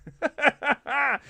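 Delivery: short burst of laughter. Real, not acted. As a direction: chuckle at your own verdict. Laughs at his rating scale.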